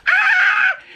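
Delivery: sudden high squeal of delight; high excited yelp; excited shriek. Real, not acted. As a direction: squeal at the post. Parcel arrives.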